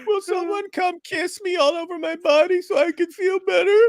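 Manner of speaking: very high-pitched whiny falsetto; mock pitiful pleading. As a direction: beg for comfort like a hammed-up crybaby. Acting out a crying, beaten man.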